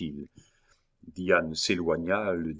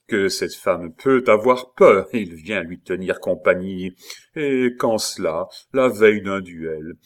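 mocking; 6 dB louder than usual. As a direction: taunt him with bitter irony. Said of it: "intonation railleuse ... vibration profonde et sombre". (raillerie sombre et profonde)

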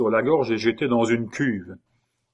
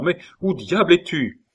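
surprised call; 7 semitones higher, 3 dB louder than usual. A narrator's reading. Searching for hidden friend.

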